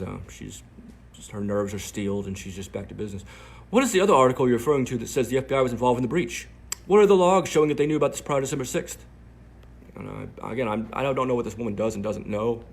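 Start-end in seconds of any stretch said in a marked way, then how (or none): 3.7-9.1 high, loud mock British reporter; a hammed-up British accent, pitched high. Reporter's DM read in a mock British accent. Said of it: "I'm doing her British accent".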